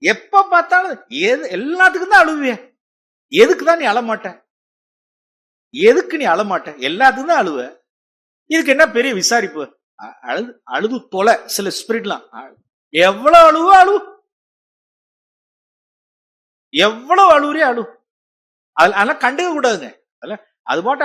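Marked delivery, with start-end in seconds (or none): 0.0-11.3 mocking scolding. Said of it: "சில பேருடைய spirit". 12.9-17.8 high, loud contemptuous yell; dismissive shout. Acted. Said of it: "சில பேருடைய spirit".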